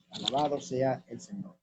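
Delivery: ordinary talk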